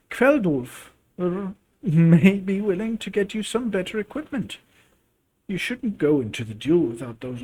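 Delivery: put-on a wise old mother advising; grandma voice.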